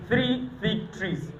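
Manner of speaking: slow, soft, word by word; slow tongue twister demo. Shown as a demonstration. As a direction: spell it out slowly for them. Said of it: "Now repeat this five times".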